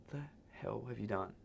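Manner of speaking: a hint of the stunned manager; manager's dismay. Manager reacting to his mess.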